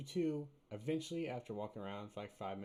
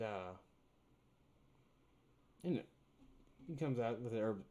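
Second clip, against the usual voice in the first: normal talking voice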